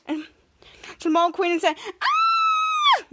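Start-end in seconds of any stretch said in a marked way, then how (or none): 2.0-3.0 very high shrieking scream; panicked shriek. Acted. Mole queen shrieks in panic when her mole is punched. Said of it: "somebody was shrieking and out of panic mode".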